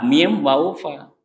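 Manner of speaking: ordinary talk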